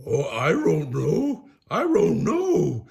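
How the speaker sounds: loud, slow cartoon dog wail; Scooby crying out. Scooby answering Shaggy in trouble, loud drawn-out wail. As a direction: howl the words like a panicked dog. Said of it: "Scooby cried".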